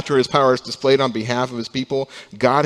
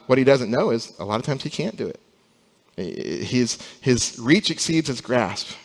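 usual speaking voice

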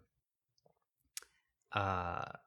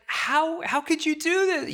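much higher, louder anguished cry; retold yell at God; 4 dB louder than usual. Angry at God over the changed plan, high loud cry. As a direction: cry out to God in hurt protest. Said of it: "I yelled at God".

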